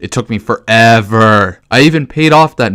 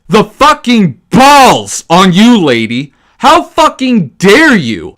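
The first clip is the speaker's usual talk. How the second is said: loud, much higher, slow indignant yelling; furious outburst; 9 semitones higher, 6 dB louder, slower than usual. Real, not acted. Gamer yelling at the character Johanka, slow furious shouting. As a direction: drag each word out in outrage.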